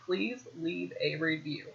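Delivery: slow, very soft model line after a laugh; soft, amused prompting. Real, not acted. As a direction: prompt it slowly and quietly, word by word.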